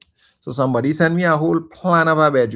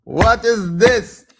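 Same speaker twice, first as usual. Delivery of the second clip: incredulous outburst; 10 semitones higher, 8 dB louder, much slower than usual. Real, not acted. Outraged at the question.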